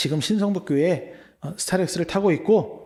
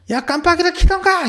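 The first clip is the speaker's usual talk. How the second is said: loud, high furious shout; shouted road-rage retort; angry yell; 12 semitones higher, 7 dB louder than usual. Retold or imitated. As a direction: yell it like a hot-tempered driver. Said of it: "이러면서 소리를 빡 지르고".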